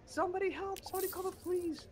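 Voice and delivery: high-pitched hammed-up cry for help; mock-frantic shrieky pleading; mock panic. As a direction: act out a hysterical old woman, voice way up. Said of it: "And she goes".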